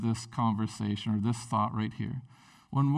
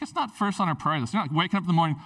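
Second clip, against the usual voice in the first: usual speaking voice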